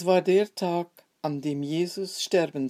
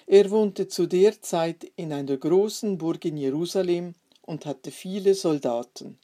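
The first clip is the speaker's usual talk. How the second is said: usual speaking voice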